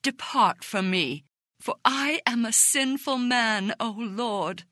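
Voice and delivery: a narrator's solemn exclamation, rising on the confession; exclaiming. A narrator's reading. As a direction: exclaim it with contrite fervour, then fall away.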